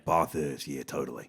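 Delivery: low, soft Aussie bloke; Aussie accent, laid back. Pretend. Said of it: "if I do an Aussie accent".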